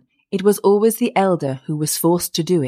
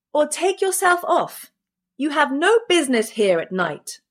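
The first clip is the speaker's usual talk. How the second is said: high-pitched indignant order; crying out; 9 semitones higher than usual. A narrator's reading. Boy ordering the night intruder away, crying out. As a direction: warn off an intruder, voice raised high. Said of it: "cried the boy".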